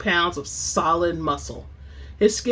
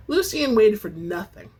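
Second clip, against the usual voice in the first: plain reading voice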